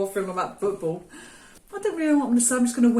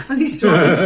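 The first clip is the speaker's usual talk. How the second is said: laughing; 6 dB louder than usual. Real, not acted. Laughing at partner.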